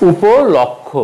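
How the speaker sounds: উপলক্ষ with open "aw" in lawk; wrong pronunciation. Shown as a demonstration. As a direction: say উপলক্ষ as "upo-lawk-kho". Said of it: "উপলক্ষ হবে না".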